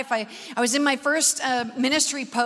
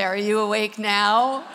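slow wake-up check; 4 semitones lower, slower than usual. Shown as a demonstration. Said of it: "turn to the person beside you and say".

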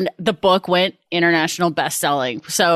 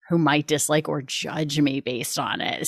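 ordinary talk

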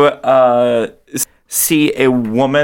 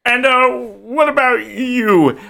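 character voice; 8 semitones higher than usual. Acted. Game master's character questions the next person, high character voice. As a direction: put on a high voice to ask.

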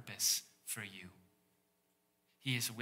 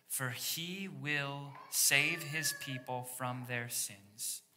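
slow scripture quote; slower than usual. Acted. Quoting angel slowly.

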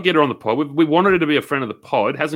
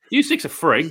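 ordinary delivery

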